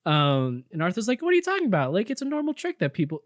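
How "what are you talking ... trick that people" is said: high-pitched, baffled protest; defensive disbelief. Acted. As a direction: push back as if wrongly accused. Acted defensive reply in quarrel.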